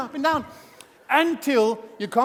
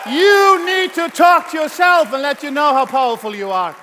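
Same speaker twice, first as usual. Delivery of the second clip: motivational shout; 6 semitones higher, 8 dB louder than usual. Real, not acted. Speaker to audience, urging self-belief, booming shout. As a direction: bellow it as a rallying call.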